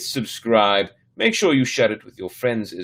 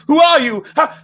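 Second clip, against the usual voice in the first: high, loud panicked questions; a scared boy faces a monster in his room, high panicked questions; terrified; 7 dB louder than usual. A narrator's reading. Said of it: "his expression terrified".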